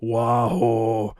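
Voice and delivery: mock-sad, drawn-out catchphrase in a put-on voice; sad Wario. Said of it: "doing something and he got sad".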